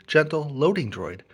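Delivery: ordinary delivery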